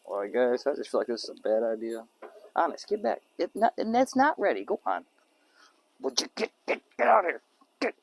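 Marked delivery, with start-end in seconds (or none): 2.5-5.0 shooing the dog. Real, not acted. Pushing dog back. 6.0-8.0 shooing the dog. Real, not acted.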